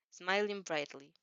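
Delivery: plain reading voice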